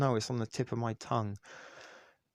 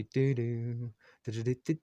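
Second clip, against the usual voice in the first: ordinary delivery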